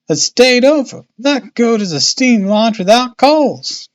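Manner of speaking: high, chatty dialect woman's voice; puzzled boatman's wife. A narrator's reading. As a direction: puzzle it out aloud, high and voluble. Narrator voices Missus Smith.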